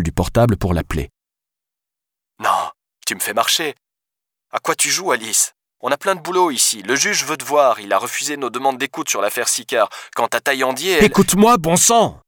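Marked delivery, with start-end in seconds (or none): 2.4-11.0 disbelieving police deputy. Acted. Voices the deputy on phone. 11.1-12.2 high-pitched, exasperated yell. A narrator's reading. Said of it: "hurla-t-elle".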